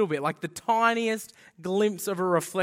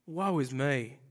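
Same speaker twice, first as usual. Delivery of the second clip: resigned woe; 6 semitones lower, 4 dB quieter than usual. Acted. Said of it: "that he goes".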